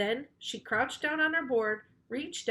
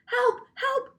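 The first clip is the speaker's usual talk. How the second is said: high loud cry for help; shouted panicked help, much higher; shouting for help; 6 dB louder than usual. A narrator's reading. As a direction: yell while running. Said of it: "shouted Miss Child".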